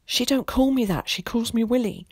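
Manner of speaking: put-on correcting boy. A narrator's reading. Willie correcting Tom's letter, piping up. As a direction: pipe up to correct him as a boy.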